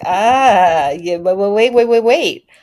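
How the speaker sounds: a hammed-up nervous investor begging for a pause; mock panicked Wall Street. Imitating Wall Street reacting to the tariffs, mock panicked. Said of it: "Wall Street is kinda going like".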